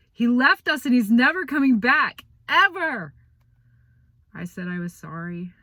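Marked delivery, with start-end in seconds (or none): 0.1-3.1 loud, high anguished yell. Retold or imitated. Boy retelling yelling at mom, loud anguished yell. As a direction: scream it at her, furious and hurt. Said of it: "when I yelled".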